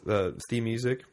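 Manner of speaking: usual speaking voice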